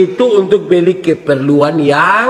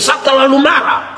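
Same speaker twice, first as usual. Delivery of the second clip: mock angry shout; 5 semitones higher than usual. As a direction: shout it like someone claiming to be furious. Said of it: "Kadang-kadang orang bilang". (teriakan marah pura-pura)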